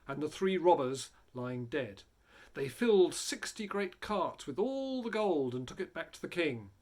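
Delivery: plain reading voice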